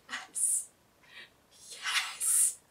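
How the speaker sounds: whispered, savoured yes. Retold or imitated. Vlogger reliving her reaction to Palmer's joke, whispered. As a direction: whisper it as a private little victory.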